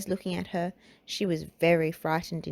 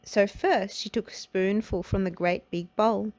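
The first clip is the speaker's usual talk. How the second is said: plain reading voice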